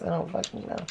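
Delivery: quiet exasperated mutter; muttered aside. Real, not acted. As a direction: grumble it to yourself.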